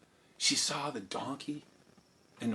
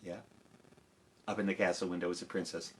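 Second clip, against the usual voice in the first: ordinary delivery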